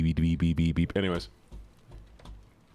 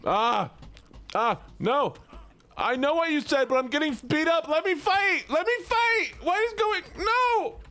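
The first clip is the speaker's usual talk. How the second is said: high-pitched panicked protest; real panic while getting beaten up; frantic yelling; 12 semitones higher than usual. Real, not acted. Streamer beaten up in game.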